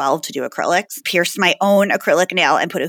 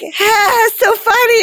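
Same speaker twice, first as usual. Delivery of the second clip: very high, mock little-girl laugh; sarcastic kid laugh; 5 dB louder, slower than usual. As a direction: roll your eyes like an unimpressed child. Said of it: "She was like".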